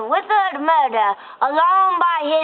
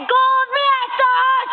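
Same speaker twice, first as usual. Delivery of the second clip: drawn-out shouted plea; slow fervent cry; 7 semitones higher, slower than usual. Acted. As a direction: cry it out slowly like a prayer. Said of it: "when they said".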